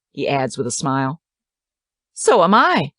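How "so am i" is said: impish, a bit higher and louder. A narrator's reading. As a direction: tease him back impishly. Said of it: "she says impishly".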